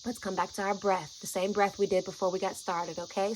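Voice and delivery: soft, calm breathing guide; quiet coaxing voice; gentle guiding voice. Retold or imitated. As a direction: soothe her into breathing. Guiding her through breathing.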